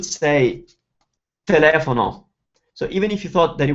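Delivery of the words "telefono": telefono with stress on "le"; correct Italian stress. Shown as a demonstration. Teacher voicing what others will say, te-LE-fono stressed. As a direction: punch the second syllable, te-LE-fono. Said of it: "they will say the word first".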